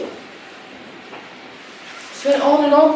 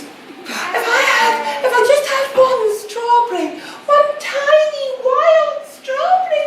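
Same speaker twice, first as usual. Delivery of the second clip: pining mock whine; 7 semitones higher than usual. Sick stepsister craving strawberries, high pleading whine. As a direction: beg for strawberries like a martyr. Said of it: "stepsister pines for strawberries".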